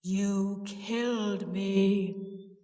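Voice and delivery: very slow, ghostly accusation; deep, haunting charge of murder. A narrator's reading. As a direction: intone each word deep and drawn out. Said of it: "Sis said in the deep voice".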